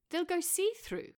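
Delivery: a touch of prim alarm; lightly voiced Claire. A narrator's reading. Claire objects to pants.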